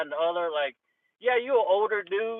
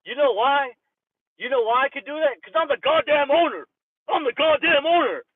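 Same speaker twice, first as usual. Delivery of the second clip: higher and louder, pounding out goddamn owner; imitated yelling boss; 7 semitones higher, 6 dB louder than usual. Gym owner shouting at his trainer, loud and angry. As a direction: yell it like an outraged owner.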